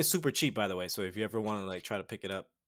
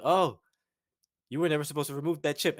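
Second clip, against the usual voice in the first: mock game announcement; 3 semitones higher, 6 dB louder than usual. Game voice quoted loudly.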